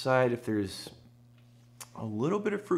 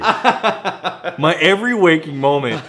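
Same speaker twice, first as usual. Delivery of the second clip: barbarian; 5 semitones higher, 9 dB louder than usual. Pretend. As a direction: roar threats like a hammy barbarian. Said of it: "you titan shouting?".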